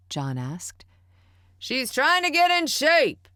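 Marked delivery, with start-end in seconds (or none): 1.6-3.3 much higher, loud, slow yell. A narrator's reading. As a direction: bellow it from the next room. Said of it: "Stanley yelled".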